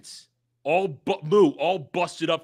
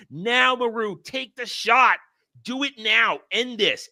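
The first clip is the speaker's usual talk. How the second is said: urgent command; 8 semitones higher, 4 dB louder than usual. Retold or imitated. Nataro orders Maru to fire.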